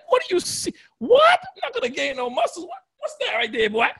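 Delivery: incredulous show-off; flexing comeback. Retold or imitated. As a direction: show off the guns, teasing. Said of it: "I'm like".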